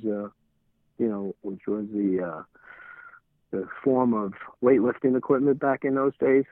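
usual speaking voice

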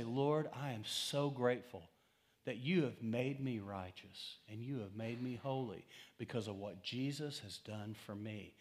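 low, softened private prayer; grateful prayer. Pretend. Preacher modeling a private thanks to God, softly grateful.

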